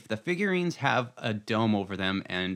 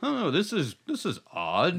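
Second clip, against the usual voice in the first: mock awkwardness; 8 semitones higher than usual. Acting embarrassed viewer with parents.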